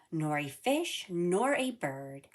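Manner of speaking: usual speaking voice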